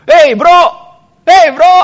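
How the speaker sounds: high, loud hail to a pal; hammed-up delight at meeting a buddy; exuberant greeting. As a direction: call out excitedly to a friend.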